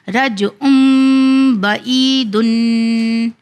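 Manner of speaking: "رجعن" with n turned to a long hummed m; iqlab recitation. Shown as a demonstration. Teacher applying iqlab for students, long held nasal. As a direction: recite "رجعن بعیدن" very slowly, holding the nasals. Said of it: "یہاں پہ بھی اقلاب کا قانون کیا".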